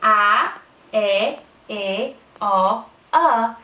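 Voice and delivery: each short vowel said out loud and clear; emphasized vowels. Shown as a demonstration. Teacher drilling the vowels for a child, emphatic. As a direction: hit every vowel hard for the kids. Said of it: "I emphasize the vowels".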